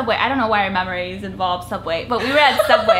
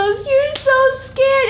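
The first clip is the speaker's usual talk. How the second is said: much higher, slow, wide-eyed mock voice; stunned friend; 5 dB louder, much slower than usual. Startled friend in carpool, slow and high. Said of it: "she was so".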